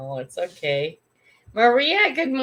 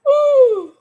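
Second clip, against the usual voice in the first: startled ooh; 12 dB louder than usual. Real, not acted. Startled exclamation on stream.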